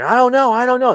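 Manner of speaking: high, loud mock pleading; imitated desperate plea. Recapping a man tortured for a key, imitated desperate plea.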